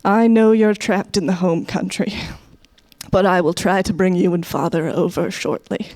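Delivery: measured, formal letter-home recital with pauses; old-time immigrant letter. Acted. Immigrant writing home to mother, mock-earnest and formal. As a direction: recite it solemnly, phrase by phrase. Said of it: "writing exactly the same letters home".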